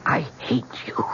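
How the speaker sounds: a hushed, breathy "I hate you"; whispered hatred. Acted. Wife whispering hatred to her husband, hushed. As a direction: breathe it out, cold and quiet. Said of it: "but I said it again".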